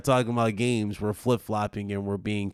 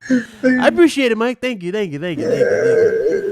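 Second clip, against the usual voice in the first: sarcastic gratitude; 11 semitones higher, 9 dB louder than usual. Pretend. Mock gratitude, raised voice.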